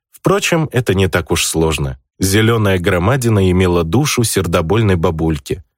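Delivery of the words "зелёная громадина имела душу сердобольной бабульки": plain reading voice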